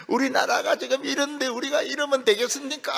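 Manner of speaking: a hammed-up sobbing plea to the flock; mock wailing preacher. Preacher wailing over the nation before his sermon, mock tearful. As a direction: lament it loudly, high and tearful. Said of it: "통곡하면서".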